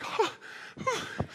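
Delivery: high, breathy catches of emotion; choked-up gasps. Retold or imitated. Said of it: "I'm getting all misty".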